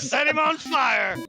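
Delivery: high, loud, slow yell; gleeful shout. Real, not acted. Game master urging players to torch Gruak, shouting gleefully. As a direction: bellow it out with glee.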